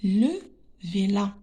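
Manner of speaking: careful, word-by-word reading with pauses; deliberate classroom reading, each word set apart. Shown as a demonstration. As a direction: read for beginners, spacing out every word. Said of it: "on va lire les phrases".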